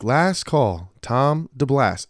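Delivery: mock final-call announcement; imitated gate announcer. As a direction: page a missing passenger as a mock gate agent. Imitates gate agent paging late passenger.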